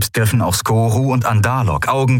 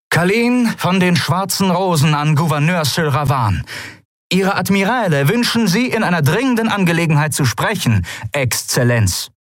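higher, formal official hail to the governor; formal address; 11 semitones higher than usual. Acted. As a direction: announce yourself with cold formality. (förmliche Anrede an den Gouverneur)

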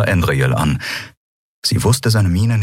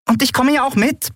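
high, thin little squeak of a voice; piping. A narrator's reading. Small companion chiming in eagerly, high piping voice. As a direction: pipe it up high like a tiny creature. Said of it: "piepste Nelen".

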